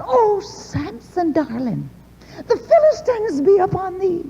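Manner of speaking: sing-song a sugary wake-up warning; mock sweet call. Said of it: "she hollered".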